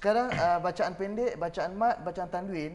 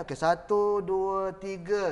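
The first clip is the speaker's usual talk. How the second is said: slow, loud count of satu, dua, tiga; slow counted beats; 4 dB louder, slower than usual. Shown as a demonstration. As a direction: drag each beat of dua, tiga. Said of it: "Ada berapa ketuk?". (kiraan perlahan dan kuat)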